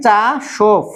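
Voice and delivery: চাসোফ drawn out in two parts, ending in f; teacher sounding out চাসোফ syllable by syllable; pronunciation demo. Shown as a demonstration. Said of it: "উচ্চারণটা কি?".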